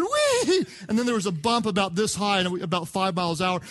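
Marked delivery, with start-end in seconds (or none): 0.0-0.7 put-on gleeful kid squeal. Dad imitating his son enjoying the ride, very high gleeful squeal. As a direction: squeal with joy like a little boy. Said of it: "I said, and then".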